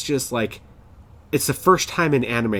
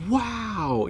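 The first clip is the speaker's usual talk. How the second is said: soft, high-pitched wow; retold awe; 11 semitones higher, 4 dB quieter than usual. Recalling first reading hero comics, retold awe. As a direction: breathe out an awed, high wow. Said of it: "and I just thought".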